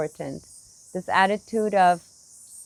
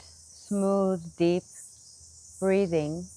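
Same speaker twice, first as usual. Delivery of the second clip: ordinary talk, nothing marked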